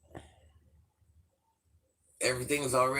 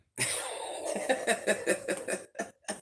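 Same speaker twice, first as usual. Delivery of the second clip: breathy laugh; 9 semitones higher than usual. Real, not acted. Laughing at own mistake.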